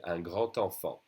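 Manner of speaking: "un grand enfant" run together with a T before "enfant"; liaison with T. Shown as a demonstration. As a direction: link "grand" to "enfant" through a T sound. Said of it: "the D would be pronounced like a T".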